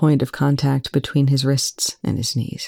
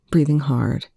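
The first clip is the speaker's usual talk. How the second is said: usual speaking voice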